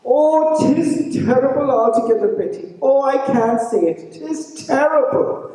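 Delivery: high, fretting husband; high distressed voice. A narrator's reading. Francie frets to Betty, high and distressed. Francie cannot bring himself to say.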